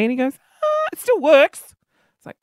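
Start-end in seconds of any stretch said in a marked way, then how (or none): 0.6-1.8 officer's reply. Retold or imitated. Voicing the officer.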